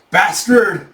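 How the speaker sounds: high, loud curse; angry curse. Acted. Bandit to masked attacker, high loud angry curse. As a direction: hurl it at the attacker.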